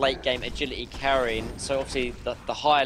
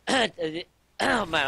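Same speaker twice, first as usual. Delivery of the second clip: losing voice; 6 semitones higher than usual. Real, not acted. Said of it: "I'm losing my voice".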